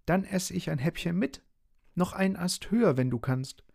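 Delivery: very high, small character voice; bossy little Schnuddel. A narrator's reading. Schnuddel tells the dog to lift him, high and bossy. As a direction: order the big one about in a high pipe.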